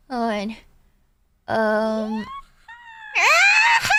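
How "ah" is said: high excited shriek; excited squeal. Real, not acted. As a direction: squeal with delight.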